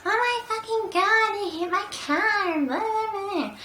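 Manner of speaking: high, slow, mocking voice of an angry driver; imitating the fired-up driver; mocking angry man. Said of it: "he was really, like, fired up".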